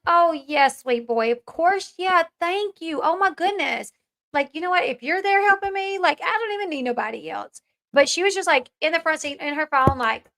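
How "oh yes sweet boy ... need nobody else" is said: put-on higher-pitched, sweetly delighted thanks to a child; gushing grateful mom. Mom thanking her little boy for offering help, gushing. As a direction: gush with exaggerated motherly appreciation. Acts out grateful mother's reply.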